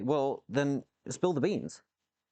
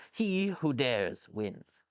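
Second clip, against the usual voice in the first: slow proclamation; much slower than usual. Acted. Quoting motto slowly.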